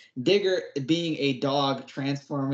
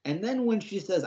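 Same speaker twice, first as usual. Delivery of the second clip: ordinary talk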